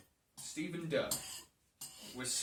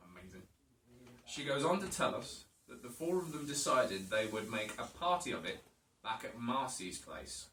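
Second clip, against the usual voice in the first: usual speaking voice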